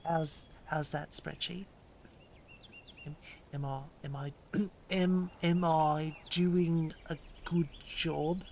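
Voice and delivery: put-on slow, deliberate American accent; American accent, drawn-out words. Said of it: "my American accent".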